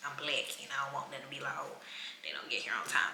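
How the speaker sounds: hushed aside. Retold or imitated. Imagined judgement from others.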